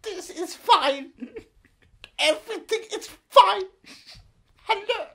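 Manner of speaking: put-on halting, high-pitched caricature with an Icelandic accent. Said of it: "Very close to [name]".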